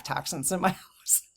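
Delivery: whispered retort, half laughing; incredulous whispered aside. Retold or imitated. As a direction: drop to a whisper for the punchline. Retorting to condescending advice.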